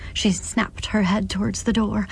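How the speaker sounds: plain reading voice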